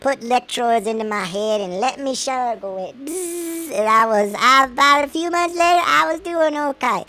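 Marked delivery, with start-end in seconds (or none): 3.1-3.7 high, soft imitated buzz; mimicked zap of electroshock; electric buzz. Electroshock in rest home.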